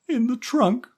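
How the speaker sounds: put-on high, loud child voice; young boy. A narrator's reading. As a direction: answer like a frightened boy. Boy voice Stevie.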